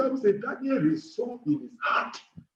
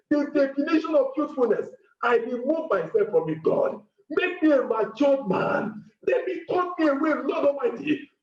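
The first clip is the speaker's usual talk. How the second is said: impassioned prayer-meeting cry; pleading preacher's prayer; fervent prayer; 6 semitones higher than usual. Acted. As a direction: lead the room in pleading prayer. Leads congregation in fervent prayer.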